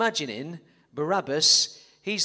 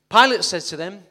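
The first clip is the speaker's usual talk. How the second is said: loud cue; 2 semitones higher, 10 dB louder than usual. Acted. Loud narration line.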